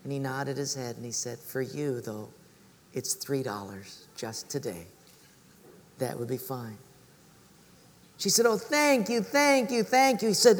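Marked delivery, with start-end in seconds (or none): 1.4-6.8 low, soft and tender; compassionate. Acted. Cobbler to a mother short of money, compassionate. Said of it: "a sweep of compassion came over him". 8.5-10.3 high, gushing gratitude; effusive thanks. Acted. Mother thanking the cobbler for cheaper shoes, effusive.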